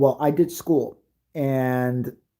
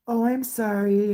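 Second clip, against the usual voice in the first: put-on high, slow childlike apology; sweet little kid; 7 semitones higher, slower than usual.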